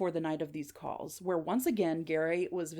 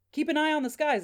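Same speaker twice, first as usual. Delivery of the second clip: higher, livelier repeat of a message; eager, raised-pitch report; animated relaying; 8 semitones higher, 4 dB louder than usual. Acted. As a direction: pass it on emphatically to someone nearby. Gary relays the message to Dad.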